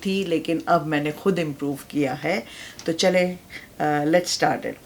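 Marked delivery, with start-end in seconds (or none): none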